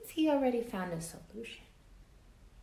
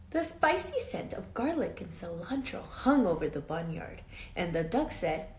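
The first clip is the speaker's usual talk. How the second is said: put-on lively read-aloud for children; story narration; 7 dB louder than usual. A narrator's reading. Teacher reading a storybook to her class, lively read-aloud. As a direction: tell it like a picture book to kids. Reads picture book to class.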